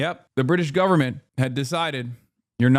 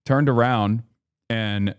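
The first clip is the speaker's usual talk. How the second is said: ordinary talk, nothing marked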